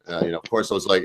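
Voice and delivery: usual speaking voice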